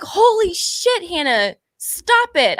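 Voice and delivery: self-scolding. Retold or imitated. Scolding herself in her head.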